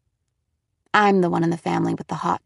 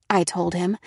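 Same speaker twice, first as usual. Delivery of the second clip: ordinary delivery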